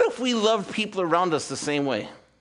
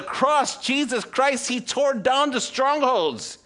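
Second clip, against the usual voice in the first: preaching build; 9 semitones higher, 3 dB louder, much slower than usual. Acted. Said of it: "preaching climax".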